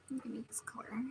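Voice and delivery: "Give me this color" whispered, no voice; whispering. Real, not acted. Repeats phrase, whispered.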